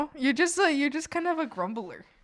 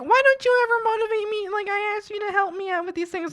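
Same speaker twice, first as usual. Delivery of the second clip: whiny demand; 10 semitones higher, faster than usual. Retold or imitated. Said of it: "they'd be like".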